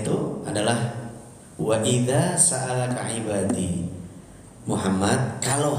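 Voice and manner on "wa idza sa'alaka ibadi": slow recitation. Acted. Quoting Quran verse slowly.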